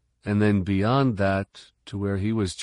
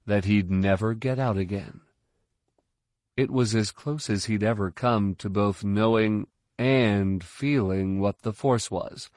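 ordinary delivery

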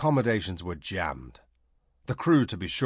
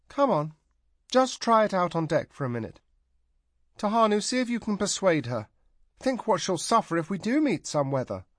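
relenting coaxing; 10 semitones higher than usual. A narrator's reading. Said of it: "Then relenting".

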